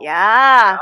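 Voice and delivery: loud, long Minnesotan "yah"; hammed-up Minnesota "yah"; Minnesota accent. Podcaster showing co-host the Minnesota accent, hammed up. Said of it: "is it the Minnesota accent?".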